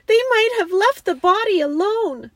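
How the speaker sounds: high, loud grieving wail; tearful protest. A narrator's reading. Girl grieving the lion's lost body, sobbing protest. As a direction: protest as if through sobs. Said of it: "sobbed Lucy".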